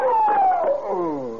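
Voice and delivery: short high shriek of terror; frightened scream. Acted. Woman screams as man beaten.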